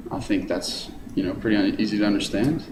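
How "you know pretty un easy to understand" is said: ordinary delivery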